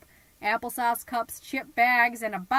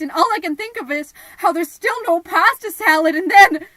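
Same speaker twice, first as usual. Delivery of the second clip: building louder and higher to a peak; rising panic; 5 semitones higher, 12 dB louder than usual. Acted. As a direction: narrate the chaos faster and louder. Tent collapsing, rising panic.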